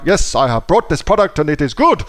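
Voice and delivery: a lightly put-on, hammy German accent; mock German buyer, stiff and proper, in a German accent; bad German accent. German buyer pleased with his purchase, in a bad German accent. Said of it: "that's a shit-ass German accent".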